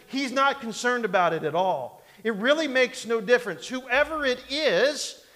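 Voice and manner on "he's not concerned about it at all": ordinary delivery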